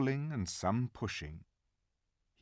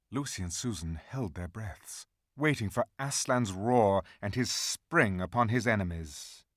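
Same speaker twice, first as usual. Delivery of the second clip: usual speaking voice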